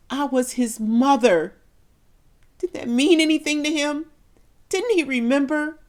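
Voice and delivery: high, loud, desperate pleading; anguished plea. Acted. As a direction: beg him to remember you. Mother's anguished pleas at the cross.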